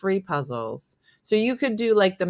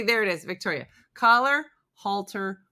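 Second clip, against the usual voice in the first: ordinary delivery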